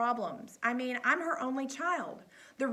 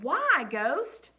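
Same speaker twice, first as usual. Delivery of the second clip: pressing question; 5 semitones higher, 7 dB louder than usual. A narrator's reading. Coach presses the boy.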